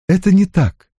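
high-pitched contradiction; firm denial. Acted. Ann firmly denies it. (возражая, высоким голосом)

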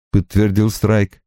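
ordinary delivery, nothing marked

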